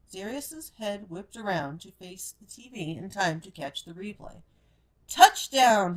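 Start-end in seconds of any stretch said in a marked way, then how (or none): 5.1-6.0 loud, high triumphant yell; shouted. A narrator's reading. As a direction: cheer the score at the TV. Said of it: "he shouted".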